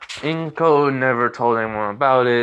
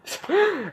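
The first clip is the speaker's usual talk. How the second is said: a short, much higher laugh through the radio; microphone laughing. Retold or imitated. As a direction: let the mic cackle high for a beat. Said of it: "he just starts laughing".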